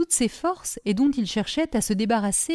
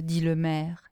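plain reading voice

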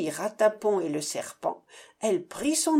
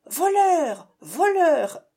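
high, loud bird cry; jay crying out; 5 semitones higher, 5 dB louder, slower than usual. A narrator's reading. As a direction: call out like a squawking jay.

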